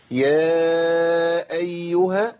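"يا أيها" ممدودة بلا قطع صوت; صِل "يا" بـ"أيها" دون سكت; correct hamza. Shown as a demonstration. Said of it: "أيوة كده". (نطق الهمزة الصحيح بلا سكت)

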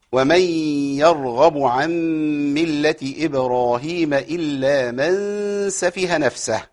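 slow, drawn-out chanted recitation; Quran recitation. Acted. Teacher reciting the verse before explaining stops. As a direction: recite the verse with measured tajweed.

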